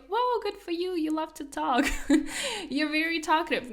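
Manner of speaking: high, bright mock praise with a laugh; hammed-up fake compliment; mock cheerful praise. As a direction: gush sarcastically like fake admirers. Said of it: "people were like".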